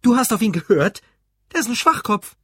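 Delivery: high, loud contemptuous outburst; angry yelling. A narrator's reading. Husband berating Ruth for listening to his rival, angry. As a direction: shout in scornful disbelief.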